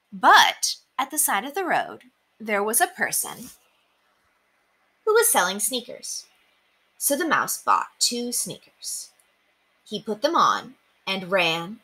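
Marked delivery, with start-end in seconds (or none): none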